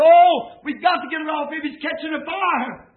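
loud, high urgent call; urgent rescuers. Retold or imitated. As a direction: hurry them, fire's coming. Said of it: "And they said".

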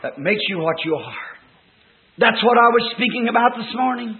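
normal talking voice

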